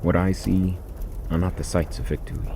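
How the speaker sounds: ordinary delivery, nothing marked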